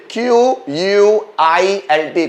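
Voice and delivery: slow spelling. Shown as a demonstration. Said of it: "quilt, quilt".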